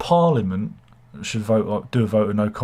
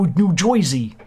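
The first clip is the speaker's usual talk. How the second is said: high, drawn-out mock New Jersey accent; 6 semitones higher, slower than usual. Shown as a demonstration. Host correcting his muddled impressions, hammed-up New Jersey accent. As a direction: exaggerate a New Jersey twang, slowly. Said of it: "I did Somerset and New Jer".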